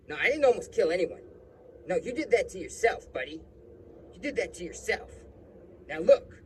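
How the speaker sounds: high-pitched, loud impression of the cop; defensive cop. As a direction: insist loudly in a mock cop voice. Retold cop defends himself, offers money.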